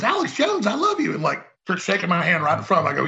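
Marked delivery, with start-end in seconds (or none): 0.0-1.1 high, delighted fan-lady voice; gushing lady fan. Retold or imitated. Lady in Hawaii greeting Alex, high and gushing. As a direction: squeal it like an adoring fan. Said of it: "the lady goes".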